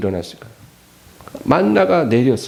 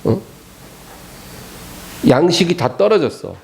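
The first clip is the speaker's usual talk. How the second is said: usual speaking voice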